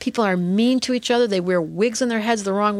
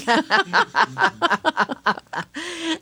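a big real laugh that rises high; hearty laugh; 6 semitones higher than usual. Real, not acted. Laughs at her own joke.

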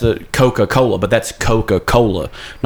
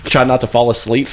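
ordinary talk

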